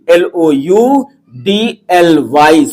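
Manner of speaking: slow, drawn-out letters; teacher's slow spelling for the class. Shown as a demonstration. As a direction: dictate it letter by letter at half speed.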